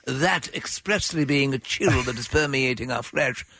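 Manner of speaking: put-on pompous, over-formal child addressing his father; mock Victorian boy. Boy alerting father to the cold, mock-formal composure. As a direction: drag out the stiff formal report, sinking slightly at the end. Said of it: "Presently the boy said".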